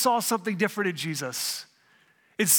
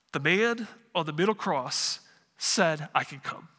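simple, humble robber; 5 semitones lower than usual. Acted. Robber answering the supervisor angel, humbly simple. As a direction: lay it out plainly, lower, piece by piece. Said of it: "The robber says".

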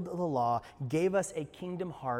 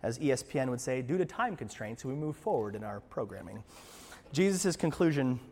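ordinary talk, nothing marked

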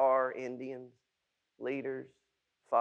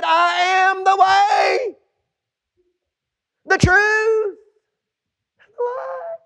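shouted scripture; 13 dB louder than usual. Acted. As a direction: cry the verse out to the rafters. Climactic scripture quote shouted.

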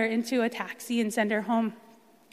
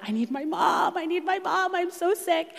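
put-on high, pleading little-girl whimper; whimpering for mom; 8 semitones higher than usual. Retelling being sick abroad, a high whimpering plea for mom. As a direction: beg for your mom like a sick child.

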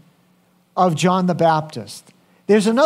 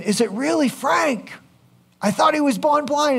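higher-pitched quote of disbelieving friends; Frank's astonished friends; 8 semitones higher than usual. Retold or imitated. Friends questioning whether the healed blind man is Frank, astonished. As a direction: imitate puzzled friends, pitch lifted. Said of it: "to his friends who are saying".

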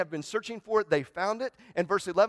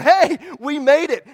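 much higher and louder, a slow triumphant cheer; joyful cheer; 10 semitones higher, 16 dB louder than usual. Retold or imitated. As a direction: celebrate arriving at last. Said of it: "smiling and laughing and, and overjoyed".

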